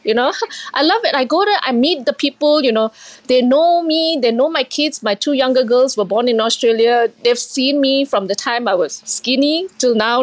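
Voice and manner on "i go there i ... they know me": usual speaking voice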